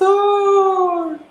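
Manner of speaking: a distant voice calls out the pastor's title; calling from far off. Acted. Elder calling from another tree, shouting from afar. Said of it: "una voz bien, bien de lejos que dice".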